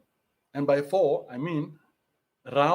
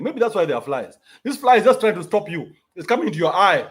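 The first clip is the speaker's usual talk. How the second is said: ordinary talk, nothing marked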